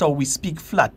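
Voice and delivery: usual speaking voice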